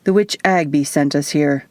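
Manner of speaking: usual speaking voice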